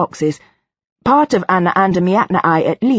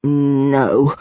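low, drawn-out, uncertain; tentative half-question; breathed, questioning no; 4 semitones lower than usual. A narrator's reading. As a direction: say it as if surprised to hear yourself. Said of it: "as though she were asking a question".

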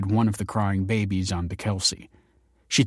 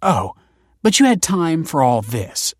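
louder, raised-pitch sarcasm; accusing retort; 7 semitones higher, 6 dB louder than usual. A narrator's reading. Kelsey pointing at beer cans, sharply accusing. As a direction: throw his excuse back at him.

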